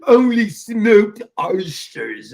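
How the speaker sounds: slow, loud put-on British accent; Clever, indignant British. Imitating Clever only eating smoked oysters, indignant British. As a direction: stretch every word in mock British indignation. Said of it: "She sounded so British".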